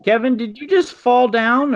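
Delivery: startled high question; surprised. Real, not acted. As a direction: caught off guard by a noise. Loud crash heard on call.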